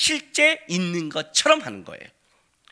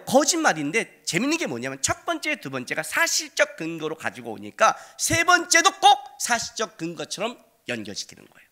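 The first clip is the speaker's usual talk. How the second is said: normal talking voice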